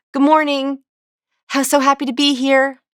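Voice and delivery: tense, high greeting with "here" left hanging upward; nervous uptalk. Shown as a demonstration. Showing a nervous first impression, "Good morning" high in uptalk. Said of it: "up talk".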